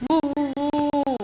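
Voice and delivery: soft hooting owl call. Shown as a demonstration. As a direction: woo woo woo, hushed. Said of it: "Repeat after me".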